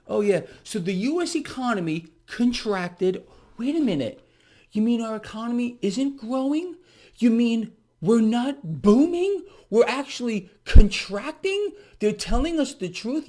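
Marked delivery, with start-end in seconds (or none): 3.6-13.3 higher and louder, slowed, fake astonishment; mock surprise. Mocking viewers about the news, fake shocked. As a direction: feign shock, hammed up.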